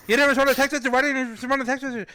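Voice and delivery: high, rapid-fire angry yelling; imitating a caller screaming at him; screaming support guy. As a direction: scream the accusation in one garbled rush. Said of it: "guy from DoorDash screamed at me".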